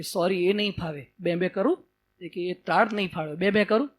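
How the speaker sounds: small creature insisting shyly; meek lion cub. Acted. Cub shows the lion its ID card, meek and soft.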